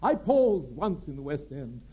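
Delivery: high, loud, grand theatrical storytelling; Gielgud's grand tale. Retold or imitated. Sir John telling the actor why, grand and loud. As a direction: spin it like a grand old actor. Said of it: "Gielgud's anecdote".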